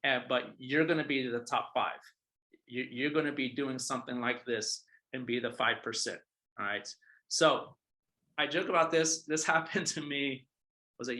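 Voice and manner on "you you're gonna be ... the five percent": ordinary talk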